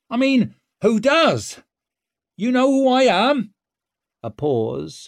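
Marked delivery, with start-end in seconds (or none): 0.1-3.5 high, brash and loud; cocky female rogue voice. A narrator's reading. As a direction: bold cheeky calling up. Ricker calling up to gates.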